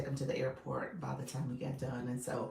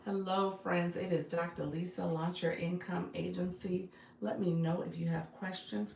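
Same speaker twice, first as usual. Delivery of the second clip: usual speaking voice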